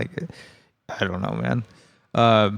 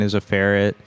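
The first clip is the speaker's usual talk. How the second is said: usual speaking voice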